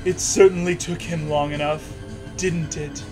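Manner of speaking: ordinary talk, nothing marked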